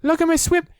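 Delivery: very high, loud squeak; squeaky little Roo. A narrator's reading. Roo showing off his swimming, high loud squeak. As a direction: squeak it like a proud tiny joey. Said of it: "said Roo".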